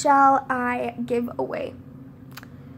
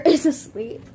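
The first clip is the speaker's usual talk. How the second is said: ordinary talk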